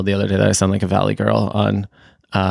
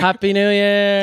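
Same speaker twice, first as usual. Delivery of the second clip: New Year cheer; 11 semitones higher than usual. Pretend. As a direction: join the mock midnight celebration. Mock countdown to the new year.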